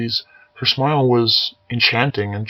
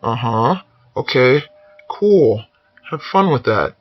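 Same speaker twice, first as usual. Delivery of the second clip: nervous; 4 dB louder, slower than usual. Acted. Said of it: "a nod filled with nervous uncertainty".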